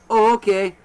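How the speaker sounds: a hint of a deflated scam caller; caller caught off guard. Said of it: "the reaction was".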